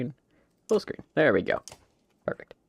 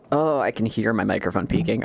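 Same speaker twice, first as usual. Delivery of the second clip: ordinary talk, nothing marked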